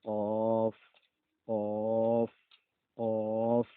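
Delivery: teacher drills the deep কফ; deep qaf. Shown as a demonstration. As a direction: stress the back-of-throat কফ. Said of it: "তারপরে দেখেন".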